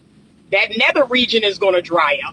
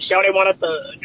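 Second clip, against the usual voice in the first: quoting Theo Vaughn; faster than usual. Retold or imitated. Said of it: "that's what I think of".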